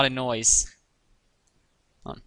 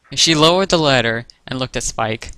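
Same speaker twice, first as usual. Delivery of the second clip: ordinary delivery, nothing marked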